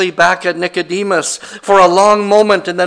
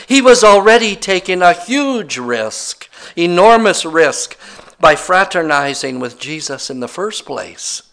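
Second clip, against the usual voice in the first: ordinary talk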